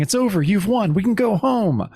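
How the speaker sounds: a hint of storybook relief; lightly voiced triumph. Spirit monk reassures the victor.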